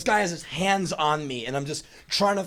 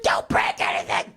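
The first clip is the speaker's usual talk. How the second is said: mom yelling orders; 6 semitones lower than usual. Retold or imitated. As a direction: bark it like a fed-up parent. Mom warning son before leaving.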